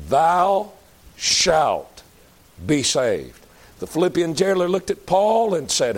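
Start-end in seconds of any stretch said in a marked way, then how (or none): 0.1-3.4 thou, shalt, be saved spaced out with pauses; slow word-by-word stress. Shown as a demonstration. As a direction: hammer out each word. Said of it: "not maybe, not hopefully".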